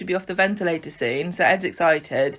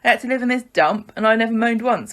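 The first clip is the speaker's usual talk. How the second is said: higher, aggrieved soap quarrel; mock indignant; 6 semitones higher than usual. Aggie reminding Ed of losing the house, mock indignant. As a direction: pile up the grievances, pitched up.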